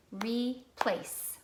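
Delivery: "Replace" in two separate beats, re and place; clapped syllables. Shown as a demonstration. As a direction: break "Replace" with a short pause after re. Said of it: "Clap replace again".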